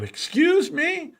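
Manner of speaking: very high, slow mock disbelief; shocked older man. Older man hears the vlogger's huge claim, in very high, slow mock shock. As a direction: stretch it out, hammed-up astonishment. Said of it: "He said".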